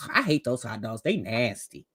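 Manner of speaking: usual speaking voice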